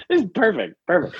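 high laughing voice. Real, not acted. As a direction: laugh it out, amused. Laughs at burping joke.

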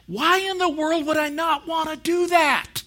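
high, loud, incredulous enthusiasm; eager exclamation. Retold or imitated. Customer to Verizon rep about the offer, high-pitched eager exclamation. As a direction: pitch it way up as an excited retold reply. Retold eager reply to saleswoman.

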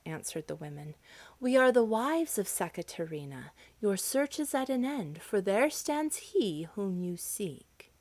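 lively storybook reading with full voice; story narration. A narrator's reading. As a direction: tell the tale to an audience. Storyteller reads the tale.

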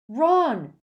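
loud, high-pitched yell; bellow. A narrator's reading. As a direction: bellow a friend's name in alarm. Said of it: "Harry bellowed".

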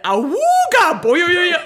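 loud, high awooga and bouncing boing; high, loud comic horn noise; imitated cartoon catcall. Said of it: "if someone catcalled me and said".

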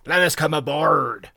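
lightly voiced croaking pirate; croaking rogue. A narrator's reading. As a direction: plead slowly as a worn-out rogue. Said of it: "croaked a hairy rogue".

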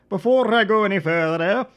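loud, high-pitched comic outburst; hammed-up silly voice. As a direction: repeat it in an exaggerated put-on voice. Mock-dramatic repeat of own phrase.

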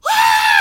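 high, loud mock shriek; imitated scream. Girl learning of the moth, mock scream. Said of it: "she starts freaking out".

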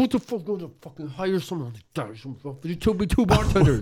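high, hammed-up garbled ranting; upset customer rant. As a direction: imitate a man losing it at the bar. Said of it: "He's getting so upset about his Heineken".

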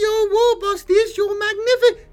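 very high, loud, slow grovelling squeal; desperate begging. Acted. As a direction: beg for your life. Grot begging the war boss.

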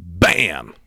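loud, punchy crash word; sudden loud bam. Retold or imitated. Preacher telling of being rear-ended at the light, loud bam. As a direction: burst out with the impact.

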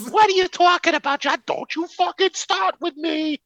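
high, hammed-up New York Italian accent; overdone Italian-American wife snapping back; mock mob wife. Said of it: "acted mob argument".

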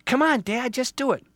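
put-on high, loud impatient kid; child's shout. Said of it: "One of the little ones shouted".

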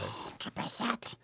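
breathy whispered agreement. Real, not acted. Agreeing with a friend in a whisper. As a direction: whisper it, all air.

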